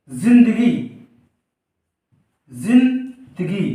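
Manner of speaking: जिंदगी with a buzzing z, zindagi, the second very slow; Urdu z sound. Shown as a demonstration. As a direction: stretch out जिंदगी with a clear z. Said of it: "जा में झनकार लगाई जाती है". (जिंदगी में ज़ की झनकार के साथ)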